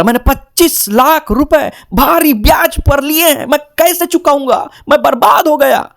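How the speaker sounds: high, slow whiny wail; hammed-up crying over debts; mock sobbing investor. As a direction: investor mock-sobbing about his loan. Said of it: "वो रोते रोते बोल रहा है".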